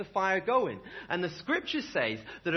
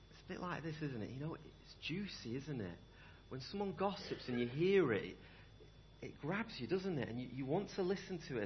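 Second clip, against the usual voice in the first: softer, confiding gossip tone; hushed aside; 8 dB quieter than usual. Acted. Softly describing juicy gossip.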